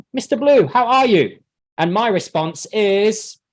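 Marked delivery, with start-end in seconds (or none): 0.1-1.4 higher, eager class question; students asking. Acted. Students ask their teacher how he is, high and eager. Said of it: "I get my students to ask me".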